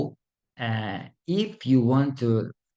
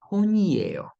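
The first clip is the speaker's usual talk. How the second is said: "Ponyeyo" run together fast as one word, pitched up; faster, run together; 9 semitones higher than usual. Shown as a demonstration. Teacher shows students "Ponyeyo" said faster as one word. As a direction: blend "Ponyeyo" quickly without a break.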